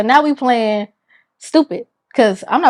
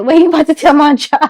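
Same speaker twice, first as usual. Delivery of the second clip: retold alarmed protest; 5 semitones higher, 6 dB louder, faster than usual. Acts alarm at being reported.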